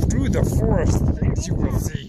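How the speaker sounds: creaky sage voice, odd word order; wise old master voice. Acted. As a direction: speak like an ancient wise master. Dad plays wise old master.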